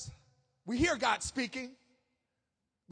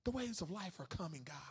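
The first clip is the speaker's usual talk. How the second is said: hushed confession; 5 semitones lower, 12 dB quieter than usual. Acted. Voices a fearful believer to God.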